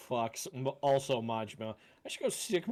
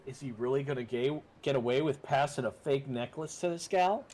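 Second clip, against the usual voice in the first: normal talking voice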